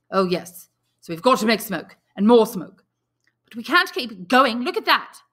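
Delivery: put-on anxious boy rallying the others; urgent excitement. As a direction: push the plea harder each line. Ralph urging the boys, rising.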